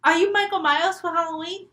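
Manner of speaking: high, loud delighted 'oh'; surprised girl. Acted. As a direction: burst out high as if recognizing a costume. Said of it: "And she goes".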